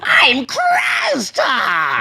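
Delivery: drawn-out, high, strained roar; Krasta. Pretend. Player defending his silly voice to friends, as an obnoxious roaring character. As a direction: announce yourself as an absurd monster, stretching the name. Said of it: "There is no other voice for that man".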